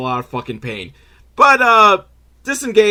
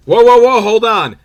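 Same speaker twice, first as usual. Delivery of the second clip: alarmed shout; 9 semitones higher, 9 dB louder than usual. Retold or imitated. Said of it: "the terrorist sees all like".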